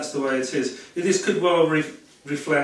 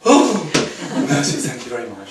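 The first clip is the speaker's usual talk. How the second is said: mock shocked lady; 6 dB louder than usual. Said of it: "French lady quoted reacting".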